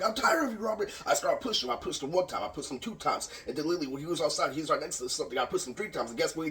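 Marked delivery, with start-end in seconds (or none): 0.0-0.9 high, heated retelling; half-acted fed-up outburst; mock exasperation, pitched up. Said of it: "I'm like".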